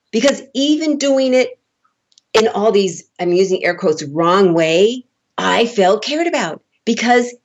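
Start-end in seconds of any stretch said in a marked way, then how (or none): none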